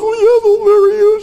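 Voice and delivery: mock teary high-pitched yes; teary-eyed. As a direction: imitate a woman choked up with happy tears. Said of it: "she's all teary-eyed".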